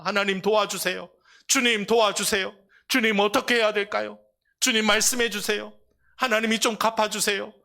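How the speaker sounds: high, loud pleading to the Lord; pleading prayer. Acted. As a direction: beg God for help out loud. Voiced prayer for help. (주님께 높고 크게 간구하는 기도)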